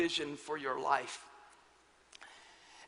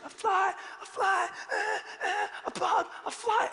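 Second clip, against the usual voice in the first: put-on high whimpering child's panic; screaming scared child; 12 semitones higher than usual. Pastor imitating son still panicking over fly, high whimpers. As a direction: mimic a terrified little boy's cries. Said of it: "He just keeps on screaming".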